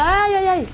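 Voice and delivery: a high, loud, exaggerated ow-ow-ow; pained wail. Real, not acted. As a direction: yelp in comic pain. Se plaint de son nez. (plainte aiguë et forte)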